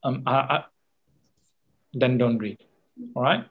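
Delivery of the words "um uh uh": stuck on a word, halting ums and uhs; demonstrated hesitation with broken fillers; hesitating. Shown as a demonstration. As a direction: stumble and stall before a hard word. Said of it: "every time I'm trying, uh, trying to say".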